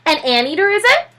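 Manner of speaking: high startled matron; fussy neighbor lady. Acted. Mrs Calhoun voiced, high.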